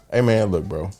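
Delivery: ordinary talk, nothing marked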